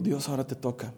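soft, low plea to God; quiet surrendering prayer. Acted. Preacher praying before he speaks, quiet surrender. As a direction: hand it over quietly, in a low voice.

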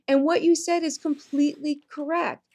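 normal talking voice

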